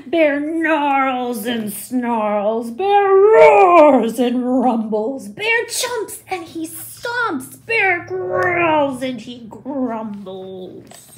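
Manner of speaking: slow, heavy monster voice; growly bear. A narrator's reading. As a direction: rumble it like a cranky bear. Bear's anger read slowly.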